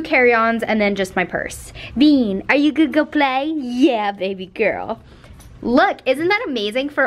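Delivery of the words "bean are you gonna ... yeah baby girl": pet-talk. Real, not acted. Owner asking Bean to go play, doting pet-talk. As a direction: coo brightly to a dog, then settle.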